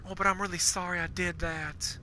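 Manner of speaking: a light mock of a wishy-washy apology; mock Bonnie apology. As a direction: imitate Bonnie's sorry, soft and simpering. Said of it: "The other".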